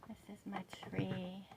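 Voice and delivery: soft whispered aside. Real, not acted. Vlogger showing her tree in a whisper. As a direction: hush it like a secret.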